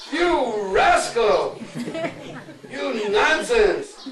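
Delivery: high, loud, drawn-out scolding; cursing mothers. Acted. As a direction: drag out each insult at the son. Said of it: "begin to curse and swear".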